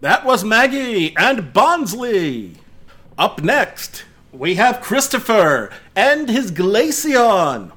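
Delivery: high, slow showy host call; contest announcer. Acted. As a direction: draw out the introduction for the crowd. Announcer introduces next contestant.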